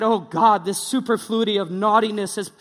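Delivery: loud, hammed-up old-English prayer; mock King James prayer. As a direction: declaim it like an archaic preacher. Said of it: "don't talk in King James".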